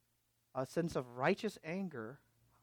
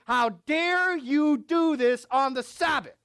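indignant Pharisee; 11 semitones higher, 11 dB louder, slower than usual. Acted. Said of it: "they say".

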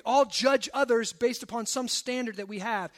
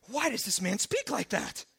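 muted indignant questioning; 2 semitones lower than usual. Acted. Scribes questioning in their hearts, indignant but held back. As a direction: pose it as a disapproving murmur of objection. Scribes' objection repeated after aside.

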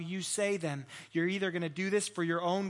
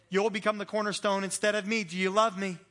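urgent, much louder and higher Jesus asking Peter; Jesus pleading, louder; 4 semitones higher, 8 dB louder than usual. Acted. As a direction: press Peter hard, voice rising and loud. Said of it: "I'll keep going to illustrate".

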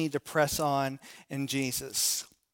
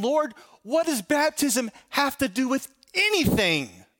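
higher, incredulous mock complaint; mock exasperated question; 7 semitones higher than usual. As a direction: act baffled and a bit whiny. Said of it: "you're thinking".

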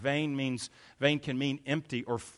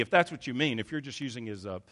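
ordinary delivery

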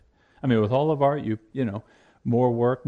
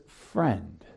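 soft, low "Friend." said as a mild rebuke; a tender word that still corrects; gentle address to a grumbling worker; very gently; 9 semitones lower, 5 dB quieter than usual. Acted. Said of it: "very gently".

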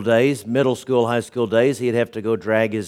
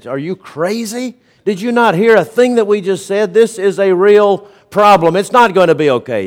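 higher, louder incredulous protest; alarmed protest; 6 semitones higher, 4 dB louder than usual. Retold or imitated. Colleagues in a meeting objecting to the friend, alarmed and higher. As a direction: push back, can't believe he said it.

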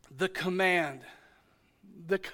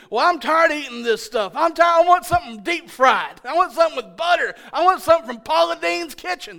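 hammed-up whine, louder and higher; whiny complaining; 5 semitones higher, 4 dB louder than usual. Israelites demanding richer food, hammed-up whine. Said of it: "That's what they said".

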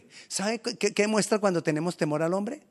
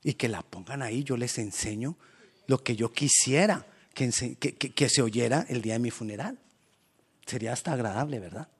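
ordinary talk

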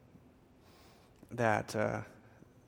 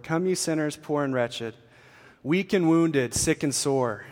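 declaimed verse, strong line openings easing at endings; hymn reading; 4 dB louder than usual. Acted. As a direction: proclaim the hymn as heartfelt invitation. Reads hymn lyrics aloud.

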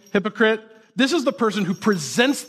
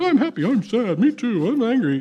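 higher, sing-song fake cheer; a hammed-up bubbly fake-happy voice; mock happy hypocrite; 5 semitones higher than usual. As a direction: parody a too-chipper churchgoer. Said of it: "They're like".

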